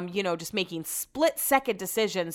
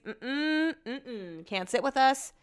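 snooty refusal; 5 semitones higher, much slower than usual. Pretend. Mock snobby rejection.

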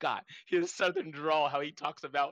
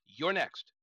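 normal talking voice

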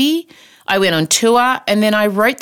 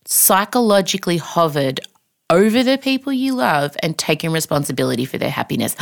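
ordinary delivery

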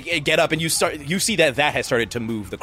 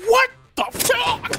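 high startled yell; shocked cry from the cave; startled shout; slower than usual. Acted. Gunman in cave startled by gunshots, high yell.

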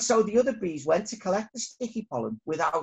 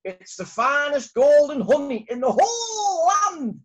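higher, louder proud townsperson; townsfolk praise; 8 semitones higher, 7 dB louder than usual. Acted. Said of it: "People in the town said".

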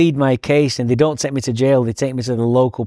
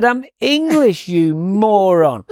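much higher, slow, mock-outraged correction; retold snap at the cops, higher and slow; indignant retort; 7 semitones higher, much slower than usual. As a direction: drag it out as a cheeky indignant jab. Correcting cops calling him Irish.

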